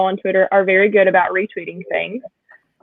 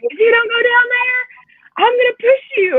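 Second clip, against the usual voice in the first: husband's mock threat; 14 semitones higher than usual. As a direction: play the husband urging, pitched way up. Husband's playful threat acted out.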